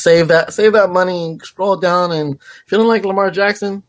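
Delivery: excited. Real, not acted. High excited pitch.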